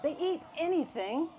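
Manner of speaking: put-on high-pitched kid's wheedling; pleading child. A narrator's reading. Child begging Mom to keep the dinosaurs, high pleading. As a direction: beg Mom like an eager child.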